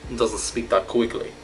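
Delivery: lightly acted Russian accent. Shown as a demonstration. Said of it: "look, I can do the Russian".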